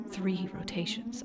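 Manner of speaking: whispered narration. A narrator's reading. Narrating being thrown.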